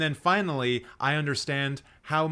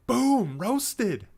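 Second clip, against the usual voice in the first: high, punchy triumph; gleeful boom; 8 semitones higher than usual. Real, not acted. Vlogger crows after finishing the roast, high and punchy. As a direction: crow it like a winner.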